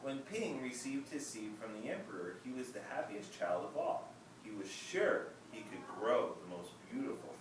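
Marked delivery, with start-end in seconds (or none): none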